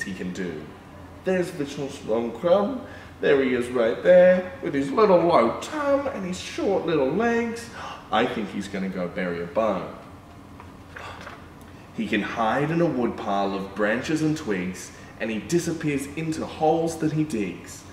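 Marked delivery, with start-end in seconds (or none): none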